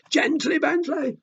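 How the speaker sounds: high calling shout; teacher scolds Bentley in class, high shout. Acted. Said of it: "The teacher shouts".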